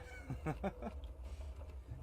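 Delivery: quiet, breathy laugh; soft chuckle. Real, not acted. Streamer amused while answering a viewer, soft chuckle. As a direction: chuckle softly under the breath.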